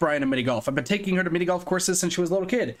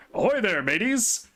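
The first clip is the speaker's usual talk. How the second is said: higher, cheery pirate greeting; Brian voice; 5 semitones higher, slower than usual. Acted. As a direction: call out like a jolly pirate. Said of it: "fake Brian and real Brian have the same voice".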